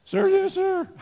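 high, loud barked military answer; shouted recruit reply. Retold or imitated. Recruit shouting reply to company commander. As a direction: snap back at the drill commander.

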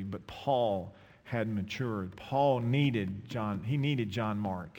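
very slow, deliberate preaching; weighty sermon point; slow emphasis. Acted. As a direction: lay each word down slowly.

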